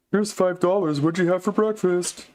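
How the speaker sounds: high whiny mock of a fan. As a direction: mimic him with scorn. Mocking the donor.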